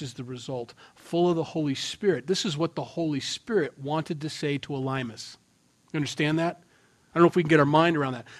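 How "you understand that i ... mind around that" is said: normal talking voice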